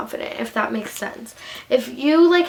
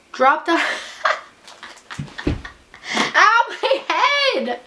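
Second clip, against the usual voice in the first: laughing slow ow, pitched up; 7 semitones higher, slower than usual. Real, not acted. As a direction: giggle through a slow pained ow. Giggles, bumps head while drinking.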